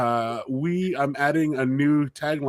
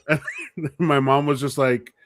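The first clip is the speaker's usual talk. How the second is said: ordinary delivery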